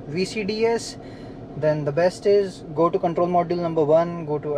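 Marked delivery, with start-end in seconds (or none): none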